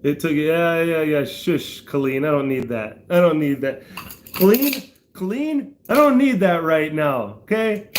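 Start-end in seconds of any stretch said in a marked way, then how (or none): 4.3-7.9 high-pitched teasing reprimand; pretend-stern telling off a chat friend; mock scolding. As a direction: scold her playfully, pitch way up.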